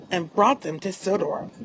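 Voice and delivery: ordinary delivery, nothing marked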